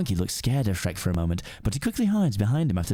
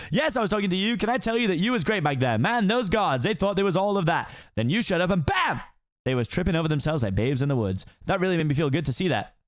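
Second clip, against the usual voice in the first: high fast-talking donkey voice; excited Donkey; 7 semitones higher than usual. Acted. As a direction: gush admiringly at the ogre. Donkey praises Shrek.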